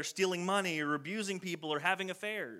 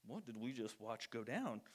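much softer, lower, dazed disbelief; stunned hush; 7 semitones lower, 13 dB quieter than usual. Retold or imitated. Disciples whispering in shock after Jesus' rebuke. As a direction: barely get it out, awestruck.

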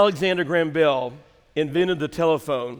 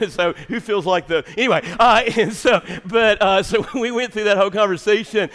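usual speaking voice

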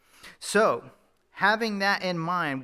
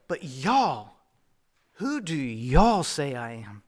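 lightly Southern, slow "But y'all, who do y'all say I am?"; a slow, gentle hint of Southern drawl; 4 semitones lower, 4 dB louder, slower than usual. A preacher retelling Jesus' question to the disciples in a mild Southern drawl. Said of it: "In the South, we say, "Y'all".

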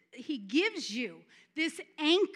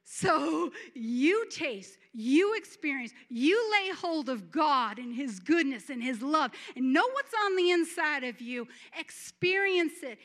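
preaching at full force; 2 semitones higher, 5 dB louder than usual. Acted. Preaching build.

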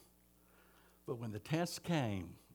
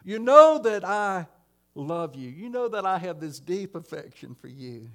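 pleading cry, louder and higher; 5 semitones higher, 9 dB louder than usual. Retold or imitated. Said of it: "Peter cries out".

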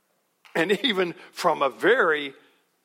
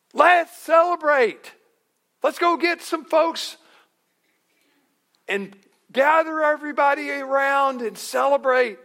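high, loud party shout; rally everyone to celebrate; hammed-up rallying cheer; mock celebration; 12 semitones higher, 9 dB louder than usual. Second mock joyful reaction.

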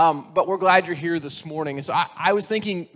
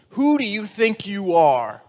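slow, loud, higher demand; stern principal; 4 semitones higher, 5 dB louder, slower than usual. Acted. Principal confronts the boy in his office, indignant. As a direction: draw out an indignant challenge. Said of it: "the principal looks at him and asks him".

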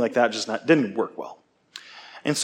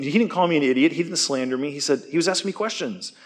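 usual speaking voice

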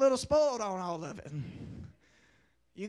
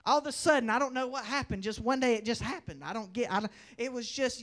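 ordinary talk, nothing marked

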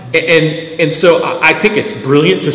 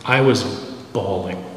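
retold grief; 6 semitones lower, 10 dB quieter than usual. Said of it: "she goes".

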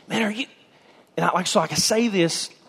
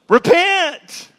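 high, louder cry of repent; prophet's cry; 12 semitones higher, 5 dB louder than usual. Acted. John calling the people to repent, a high cry. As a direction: call out like a prophet.

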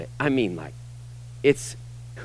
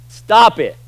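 louder, higher, blunt stop; sharp command; 6 semitones higher, 6 dB louder than usual. Real, not acted. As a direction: cut them off firmly. Preacher commanding listeners bluntly.

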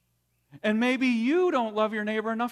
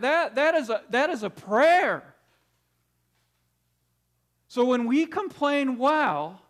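louder, higher, pounding on each that; preaching at full force; 6 semitones higher, 4 dB louder than usual. Real, not acted. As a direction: drive the point home from the pulpit. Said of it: "preaching the point".

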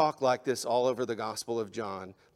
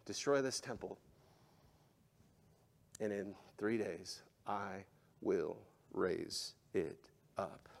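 hushed quote; 6 semitones lower, 11 dB quieter than usual. Shown as a demonstration. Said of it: "Is he not just saying".

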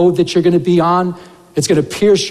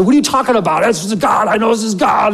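upset protest; 3 semitones higher than usual. Retold or imitated. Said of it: "And I get all upset".

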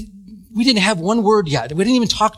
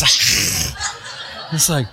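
laughing; 7 semitones lower, much slower than usual. Real, not acted. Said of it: "her fangs were coming out".

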